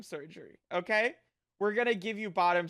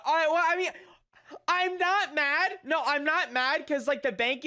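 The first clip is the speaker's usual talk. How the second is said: defensive; 8 semitones higher, 4 dB louder than usual. Real, not acted. Insists not mad, high loud.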